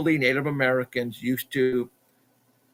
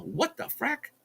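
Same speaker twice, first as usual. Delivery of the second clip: high-pitched, softer, stunned exclamation; retold disbelief; 12 semitones higher, 7 dB quieter, slower than usual. Climber finds both trail cameras missing, stunned high exclamation. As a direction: squeak out your disbelief.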